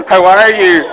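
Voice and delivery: loud laughing hello in a mock Scottish accent; hammed-up Scottish greeting, laughing. Shown as a demonstration. Brewer leads viewers in a Scottish-accent hello, loud and laughing. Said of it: "bonus points for Scottish accent".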